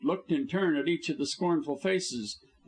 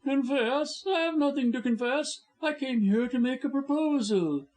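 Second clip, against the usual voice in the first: a lawyer's voice pitched higher, cool and insolent; smug blackmailer; 12 semitones higher than usual. A narrator's reading. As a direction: play the impudent scoundrel. Blackmailer voice again.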